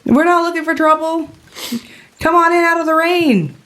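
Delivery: very high put-on woman's voice; inviting orc woman. Woman in the cave invites stranger out of rain, high-pitched. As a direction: coax them in with a high voice.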